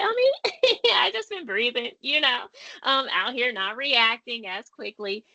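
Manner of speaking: high, coy, laughing oh me; coy modest reply. Pretend. Answering a compliment about changing, high coy voice. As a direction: play it bashful and pleased with a laugh.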